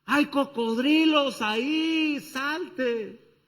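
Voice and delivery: high, loud, slow yell from far off; shouted warning. Acted. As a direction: call across the river in alarm. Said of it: "le gritan".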